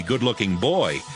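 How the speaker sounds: ordinary talk, nothing marked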